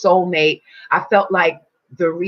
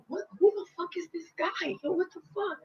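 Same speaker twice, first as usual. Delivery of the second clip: reliving the shock of the energy rush, rising to a scream; astonished disbelief. Retold or imitated. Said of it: "And I was like".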